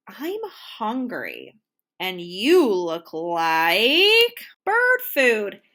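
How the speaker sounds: slow, then a loud menacing shout; hungry bird threat. A narrator's reading. Hungry bird threatens tiny millipede, slow then loud shout. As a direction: drag it out, then pounce loudly.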